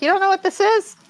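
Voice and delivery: high-pitched imitated incredulous question; mock disbelief, pitched well up; lightly imitated challenge; Mark Wahlberg. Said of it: "He's like".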